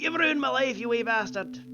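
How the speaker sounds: mocking Scottish mother telling off her bad son; imitating mom. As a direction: mimic his mother giving him grief in a Scottish accent. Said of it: "My mom was the one who was always like".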